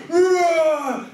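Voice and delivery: loud, high imitated bear roar. Bear jumps at the android, loud roar.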